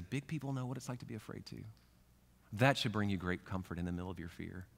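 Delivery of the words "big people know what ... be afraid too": much softer, quicker comforting line; soft reassurance. Retold or imitated. Believer reassuring someone afraid, soft and quiet. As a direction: murmur the comfort gently.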